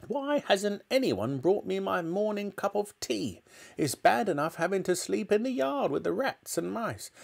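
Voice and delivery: put-on high-pitched indignant old-woman rant; shouting Grandma. A narrator's reading. Grandma glaring down at the family, high indignant shouting. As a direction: complain imperiously in a high voice. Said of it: "she shouted".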